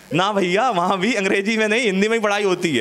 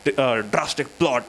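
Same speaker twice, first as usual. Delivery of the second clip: slow, low, drawn-out dramatic teacher voice; imitating teacher's shocked reading; mock teacher shock; 7 semitones lower, much slower than usual. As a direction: drag it out like a shocked English teacher. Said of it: "अब ma'am कह रही".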